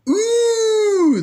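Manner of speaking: very high, loud amazed ooh; gasping ooh. A narrator's reading. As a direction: gasp in wonder like kids. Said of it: "they gasped".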